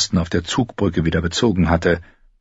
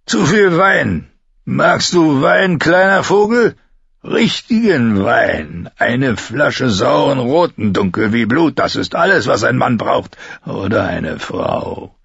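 put-on drunken rambling; 5 semitones higher than usual. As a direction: savour it like a drunk. Betrunkener Bluthund schwärmt vom Wein. (betrunken und schwelgend, langsam)

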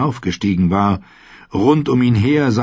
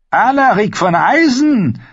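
put-on much higher and louder old noblewoman; a tart old matriarch making her request; old lady Olenna; 5 dB louder than usual. A narrator's reading. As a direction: alte spitze Adelsdame, viel höher. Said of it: "sagte Lady Olenna Tyrell".

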